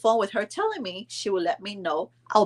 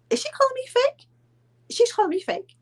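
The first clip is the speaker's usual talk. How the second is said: much higher, incredulous exclaim; indignant disbelief; 3 dB louder than usual. Retold or imitated. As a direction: rise up in outraged disbelief. Disbelief at insult.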